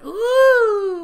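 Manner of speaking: very high, loud drawn-out "ooh"; hammed-up cartoon coo at full volume; Majin Buu. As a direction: squeal one long, high ooh. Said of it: "there's Majin Buu, just going".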